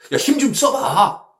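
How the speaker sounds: loud, high call; playful coaxing shout; mock urging. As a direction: cheer someone on to push. Mock quote urging effort.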